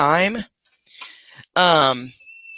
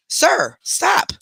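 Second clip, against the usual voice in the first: sharp command to pet; 13 semitones higher than usual. Real, not acted. To the pet behind the TV, a sharp high stop. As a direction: stop jumps way up in a sharp order.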